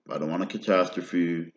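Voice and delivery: normal talking voice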